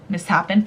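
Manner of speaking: "mishappen" said as mis-HAPPEN; misread misshapen. Shown as a demonstration. Owning up to a reading mistake, "mishappen" said as mis-happen. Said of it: "in my head, it was mishappen".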